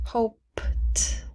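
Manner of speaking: "hoped" slowed, P then a separate T; silent E. Shown as a demonstration. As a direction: pause before the final T in "hoped". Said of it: "We do not pronounce the E".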